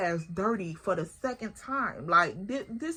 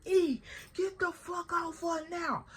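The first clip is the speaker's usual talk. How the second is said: higher, slow mock command from her brother; brother yelling; 7 semitones higher than usual. Brother ordering his sister off the girl, higher and slow. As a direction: play the big brother pulling her off the fight. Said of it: "my brother like".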